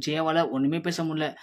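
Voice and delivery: plain reading voice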